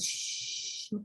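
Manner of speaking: sh held long and soft like hushing someone; slow, quiet shh to ask for silence; shushing sh. Shown as a demonstration. Teacher showing sh like hushing a room. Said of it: "como mandar a callar".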